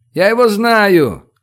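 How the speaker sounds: high, drawn-out excited call; slow high-pitched holler; shout upward. A narrator's reading. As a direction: shout it up to people above. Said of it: "крикнул он наверх".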